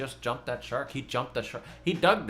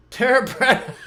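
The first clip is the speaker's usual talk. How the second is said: much higher and louder, breaking into a laugh; laughing exclamation; 12 semitones higher, 11 dB louder than usual. Shown as a demonstration. Repeating the name for the viewers, laughing. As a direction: belt it out while cracking up. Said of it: "I say it three times".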